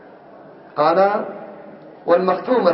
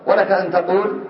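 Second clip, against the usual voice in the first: normal talking voice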